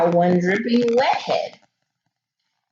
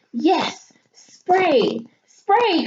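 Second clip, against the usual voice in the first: higher, rising excitement; eager cry; 8 semitones higher, much slower than usual. A narrator's reading. Mom and sister urging dad on, excited and higher. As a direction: cheer it on, getting higher. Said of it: "cried out Mom and Emily".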